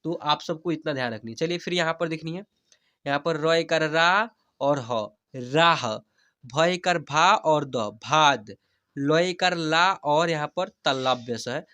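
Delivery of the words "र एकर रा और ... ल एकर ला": very slow teacher's demo, long stretched vowels; slow spelling-out. Shown as a demonstration. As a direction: spell each letter slowly, then draw out the whole word. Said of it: "जैसे मैं हिजे करा कर पढ़ रहा हूँ". (धीरे-धीरे हिज्जे करके, स्वर खींचकर)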